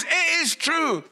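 high, slow mock scolding; angry wife. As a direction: hammer it out as the cross wife. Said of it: "She was angry".

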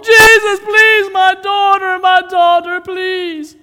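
loud, high, drawn-out wail; acted screaming plea, easing at the end; desperate pleading. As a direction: cry out endlessly for help. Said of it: "she's gone on for so long".

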